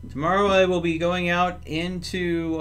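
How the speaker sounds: ordinary talk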